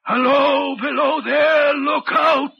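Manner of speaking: high, loud call from afar; ghostly shouted warning. Retold or imitated. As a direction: hail someone far below, then warn. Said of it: "And then again".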